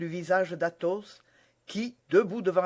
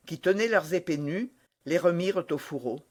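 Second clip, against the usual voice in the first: usual speaking voice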